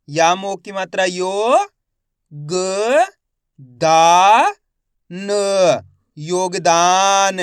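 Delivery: slow spelling drill, each syllable held with a rising end; stretched syllables for beginning readers; letter-by-letter sounding out. Shown as a demonstration. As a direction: sound out each letter, then blend the word. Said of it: "एक एक अक्षर का उच्चारण कर रहा हूँ".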